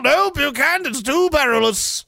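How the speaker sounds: high comic insistence; firm mock-heroic refusal up high; refusing knight. Acting rescuer's refusal.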